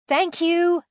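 high, loud call. A narrator's reading. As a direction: call down to people far below. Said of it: "Kyria called".

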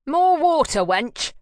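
barked order, loud and high; loud demand. Acted. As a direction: shout the order at a servant. Jackal orders sister roughly.

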